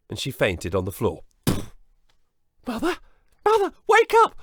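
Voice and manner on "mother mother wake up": put-on high loud boyish plea; Jack pleading. Jack urging fainted mother to wake, shouting. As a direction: shake her awake with your voice.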